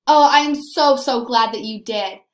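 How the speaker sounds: ordinary delivery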